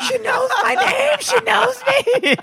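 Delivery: high, slow, laughing disbelief; giddy. Retold or imitated. Thrilled that Christina knows her, high giddy laughing.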